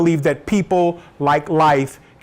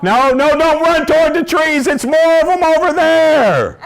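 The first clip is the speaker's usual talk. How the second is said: high, loud paranoid yell; hammed-up panic, shouted high; panicked warning; 12 semitones higher, 5 dB louder than usual. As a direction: cry out a frantic warning, stretching the last word. Paranoid warning about squirrels.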